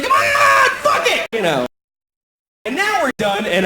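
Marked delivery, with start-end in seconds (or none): 0.0-1.3 imitating her high shout; demanding lover. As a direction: bark it like a pushy lover. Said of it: "She's like".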